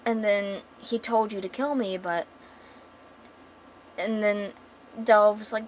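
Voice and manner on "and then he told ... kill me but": usual speaking voice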